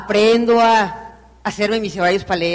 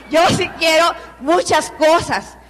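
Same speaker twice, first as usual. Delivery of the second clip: a big rallying shout of what she wants; emphatic declaration; 6 semitones higher, slower than usual. Real, not acted. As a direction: proclaim it to the whole room.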